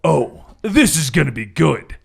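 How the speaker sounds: smug villain line, barely voiced; smirking villain. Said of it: "Red Death smirks".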